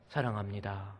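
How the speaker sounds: soft, tender thanks to a wife; warm. Shown as a demonstration. Pastor showing husbands how to speak to their wives, warm and soft. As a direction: say it gently and lovingly. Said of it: "따뜻하게 한번 우리 말해봅시다".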